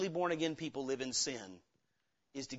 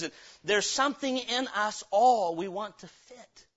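usual speaking voice

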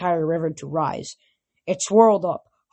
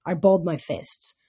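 plain reading voice

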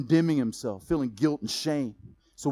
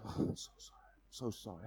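breathy whisper of a sorry cub; whispered apology; 6 semitones lower, 18 dB quieter than usual. Retold or imitated. Sorry cub whispers to his father in shame. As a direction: whisper it, ashamed and pleading.

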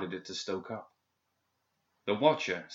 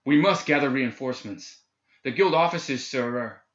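higher, louder urgent plea; 4 semitones higher, 5 dB louder than usual. A narrator's reading. Shaken guard urging Hoffman to get reinforcements, urgent and raised. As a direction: press the officer urgently, voice raised. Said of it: "He became urgent once more".

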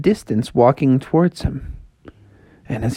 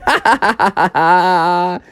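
gloating snow woman; 5 semitones higher, much slower than usual. Acted.

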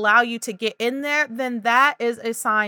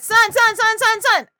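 put-on high, loud toddler chant; a little one fixated on one word; child repeating; 11 semitones higher, 5 dB louder than usual. Shown as a demonstration. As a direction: chant it over and over like an insistent toddler.

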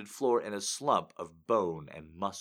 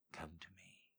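soft unvoiced whisper, much softer; Dean's whisper; 22 dB quieter than usual. Dean whispering to Maya in bed, hushed whisper. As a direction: whisper it close to her ear. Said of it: "he said".

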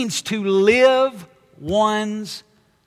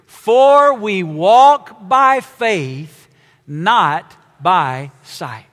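slow, louder unison scripture reading; reading aloud together; 3 semitones lower, 5 dB louder, much slower than usual. Acted. Preacher leading the congregation in reading the verse, loud and slow. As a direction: read it out loud, slow and strong with the room. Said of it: "read this verse out loud with me".

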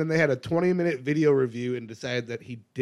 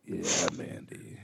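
unvoiced slow whisper; 7 semitones lower, 9 dB quieter, much slower than usual. Real, not acted. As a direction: breathe it out, slow and low. Whispered teasing at Mandy.

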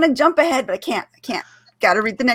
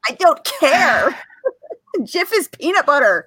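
playful defiance; 8 semitones higher than usual. Real, not acted. Playful gif argument.

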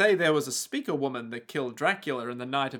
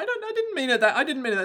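flustered; 10 semitones higher, much faster than usual. Retold or imitated. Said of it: "is like".

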